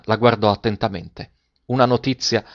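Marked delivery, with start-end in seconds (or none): none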